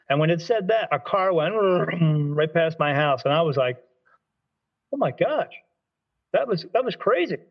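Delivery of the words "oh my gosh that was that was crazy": amazed. Retold or imitated. Amazed by the car passing.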